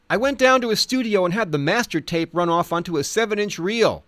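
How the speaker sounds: a light touch of the Ledge's own voice; quoting the Ledge. Retold or imitated. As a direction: quote him with a lifted, eager voice. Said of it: "he recalled".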